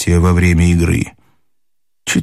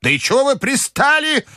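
very high, exasperated granny cry; shouting grandmother. A narrator's reading. Grandmother to the pestering party, exasperated cry. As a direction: cry out high, fed up with them. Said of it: "закричала бабушка".

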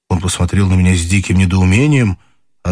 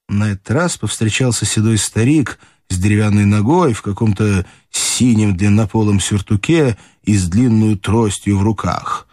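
usual speaking voice

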